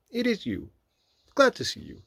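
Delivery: very high character voice; welcoming Rabbit. A narrator's reading. Rabbit looking Pooh over and greeting him, high voice.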